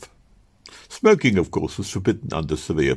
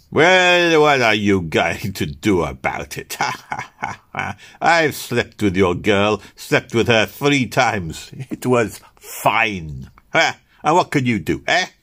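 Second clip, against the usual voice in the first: jeering taunt; 3 semitones higher, 3 dB louder, slower than usual. Acted. Morandi gloats to the weaker rival, jeering. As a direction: taunt him, slow and gloating. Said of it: "Morandi jeered at him".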